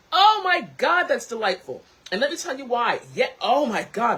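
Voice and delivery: loud high excited gush; real loud delight. Real, not acted. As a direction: rave about the taste. Delighted by tea.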